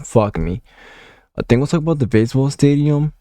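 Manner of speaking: ordinary talk, nothing marked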